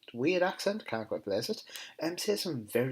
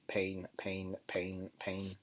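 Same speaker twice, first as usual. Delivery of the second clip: lightly mimicked monotone speaker voice; imitated pain button; 4 semitones lower, 7 dB quieter, much slower than usual. Patient keeps pressing the button for the nurse, mimicked monotone. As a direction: repeat it low, soft and level like a recording. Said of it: "he's pressing a button saying".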